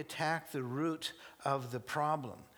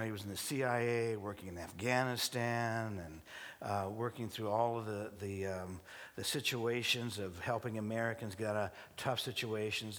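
normal talking voice

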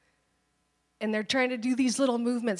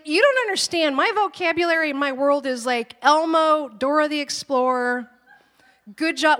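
much higher and louder, pleading; exasperated protest; 5 semitones higher, 6 dB louder than usual. Retold or imitated. Mom arguing with God about her toddler world, loud exasperated plea. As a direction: throw up your hands at God, fully worked up. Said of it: "I went toe-to-toe with God".

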